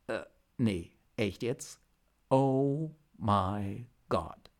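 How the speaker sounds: halting, then slowly drawn-out dismay; stunned disbelief. A narrator's reading. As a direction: let the bad news sink in word by word. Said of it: "Er starrte fassungslos".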